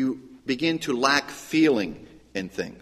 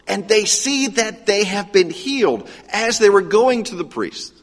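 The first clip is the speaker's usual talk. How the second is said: higher and louder, preaching with rising fervor; building excitement; 6 semitones higher, 3 dB louder than usual. Acted. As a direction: drive the story to its joyful peak. Preaching climax.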